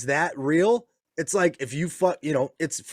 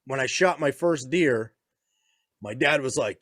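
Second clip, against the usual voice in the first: normal talking voice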